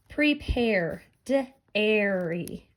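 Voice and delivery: "Prepare, dairy" as pre-pair, d... airy; sounded-out matching sounds. Shown as a demonstration. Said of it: "I hear the same sounds".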